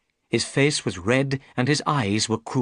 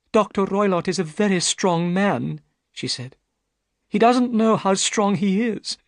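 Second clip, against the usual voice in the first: put-on young woman's voice, raised higher; a worried young lady pleading her case; Miss Stoner; 11 semitones higher, 3 dB louder than usual. Miss Stoner tells Holmes her trouble, higher anxious young woman.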